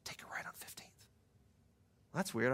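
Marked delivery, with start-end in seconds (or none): 0.0-1.1 whispered nudge; still small voice. Retold or imitated. God nudging a driver to turn, whispered. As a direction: whisper it as a gentle inner prompting. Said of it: "this still small voice kinda nudging me".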